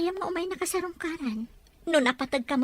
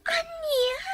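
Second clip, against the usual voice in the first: sharp, very high crying gasp of a word; shocked cry; 13 semitones higher than usual. Acted. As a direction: break into a tearful cry of disbelief. Wife learns of memory loss.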